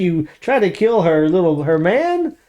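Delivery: high, disbelieving comeback; mock incredulous retort. Answering the villain in a mock retort. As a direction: scoff at her nerve. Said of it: "I'm like".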